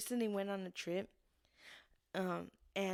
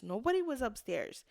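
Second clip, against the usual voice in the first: ordinary talk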